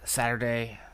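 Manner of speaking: big, loud opening announcement with drawn-out hello; podcast host intro. Real, not acted. Host greeting listeners to open the weekly podcast, announcer-style intro. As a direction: punch out the show's welcome like an announcer.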